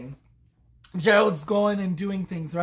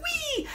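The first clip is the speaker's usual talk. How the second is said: very high, soft "wee"; tiny high squeal of someone whooshing away; mock gleeful squeal; 5 dB quieter than usual. Imitating her flying off gleefully.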